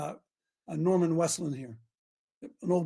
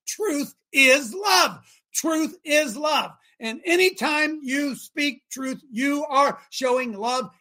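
slow, raised sermon delivery; emphatic preaching; 6 semitones higher, 6 dB louder, much slower than usual. Real, not acted. Preacher telling listeners truth is love, slow and emphatic. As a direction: land each word slowly and firmly.

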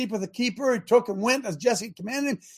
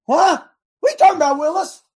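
high, loud incredulous outburst; indignant what; 7 semitones higher, 7 dB louder than usual. Acted. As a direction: burst out in disbelief. David indignant at the offer.

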